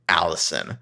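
low imitation of a bitter woman. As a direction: drop the name low with mock bitterness. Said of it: "[name]'s voice takes on this bitter [name]".

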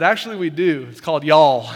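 loud, laughing quip; retold heckle. Pastor retelling a congregant's joke, loud and laughing. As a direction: toss it off with a laugh. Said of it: "someone last hour said".

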